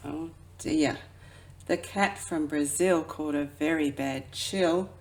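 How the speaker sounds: ordinary delivery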